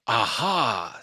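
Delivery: loud practice aha; practicing aha. Acted. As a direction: try out the aha with gusto.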